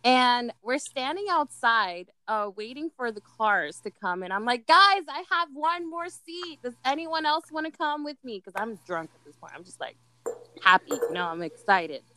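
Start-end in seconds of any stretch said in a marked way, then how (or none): 4.7-8.4 yelling. Retold or imitated. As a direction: call out to the group.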